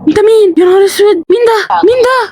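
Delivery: high, alarmed cry after a gasp; real jump-scare alarm; startled panic. Real, not acted. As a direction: react in sudden fright. Startled by something in game.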